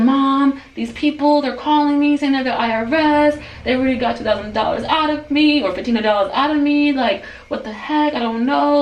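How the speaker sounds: high-pitched, flustered pleading to mom; agitated retelling, voice kept high; retold agitation. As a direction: spill it out, worked up. Retold frantic call to mother.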